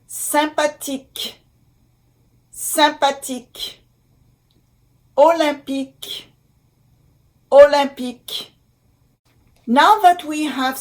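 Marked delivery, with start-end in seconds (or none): 0.1-3.8 "Sympathique" in French, nasal "sym-"; careful French pronunciation. Shown as a demonstration. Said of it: "Y M". 5.2-8.5 "Olympique" in French, nasal "-lym-"; careful French pronunciation. Shown as a demonstration. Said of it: "Y M".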